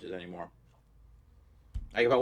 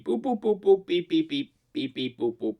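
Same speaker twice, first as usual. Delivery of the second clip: silly clown; 6 semitones higher than usual. Pretend. Silly sound noises to open.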